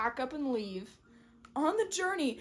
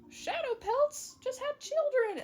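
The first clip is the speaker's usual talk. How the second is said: high excited cats; 14 semitones higher than usual. Retold or imitated. Cats calling Jay's Wing over to see the kits, high and eager. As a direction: call him over in a high voice.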